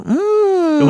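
a high, delighted hum of approval; impressed mm. Retold or imitated. Friend recalling tasting the Beyond Sausage pizza, high delighted mm. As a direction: savour it with a high happy mm. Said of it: "I was like".

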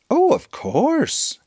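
high, very slow granny voice; high slow grandma answering gladly; sweet grandma. Acted. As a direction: drawl it out fondly. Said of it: "answered Grandma".

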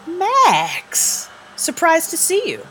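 loud, high fake delight; mock surprise. Clan leader greets the late neonate, mock surprised. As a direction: oversell the surprise to needle him.

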